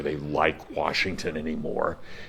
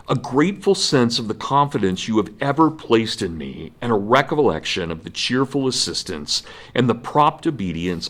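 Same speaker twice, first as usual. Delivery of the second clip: higher, louder, declaiming like an eighteenth-century general; solemn speech reading; 4 semitones higher, 4 dB louder than usual. Retold or imitated. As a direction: read it as a grand formal address to the troops. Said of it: "I'm just gonna read you a couple of paragraphs".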